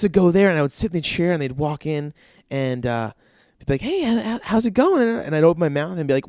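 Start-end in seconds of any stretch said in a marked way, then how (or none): 3.8-5.0 high-pitched, chirpy mock dentist hello; friendly greeting. Dentists walking in to greet a patient, friendly.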